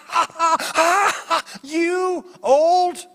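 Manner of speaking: huge high mock guffaw, words drawn out; deep belly laughing. Angel laughing at the old pastor, uproarious. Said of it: "they were laughing, I mean, from deep, deep down in their belly".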